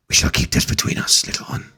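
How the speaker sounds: low, whispered secret-keeping; Voro confiding. Acted. GM as Voro promising Pim secrecy, low whisper. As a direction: keep it between the two of you in a whisper.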